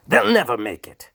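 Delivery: high, loud scream. A narrator's reading. The Duke screams at Hark, high and loud. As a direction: shriek it at the top of the voice. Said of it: "the cold Duke screamed".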